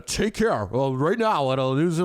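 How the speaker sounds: mock news anchor read; lightly imitating a TV reporter. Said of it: "she puts on that reporter voice".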